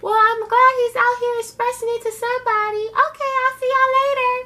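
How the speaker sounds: breezy dismissive exit with a laugh; sassy Miss Chalet. Retold or imitated. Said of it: "And she was like".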